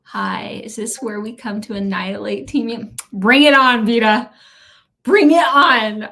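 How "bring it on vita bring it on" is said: loud, drawn-out taunt; playful challenge. Real, not acted. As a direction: call out the challenge much louder. Playful team challenge to Vita.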